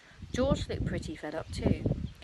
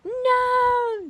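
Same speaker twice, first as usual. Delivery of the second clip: loud, much higher horrified no; horrified cry; 10 dB louder than usual. Acted. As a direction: cry out too late. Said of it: "the other two cried".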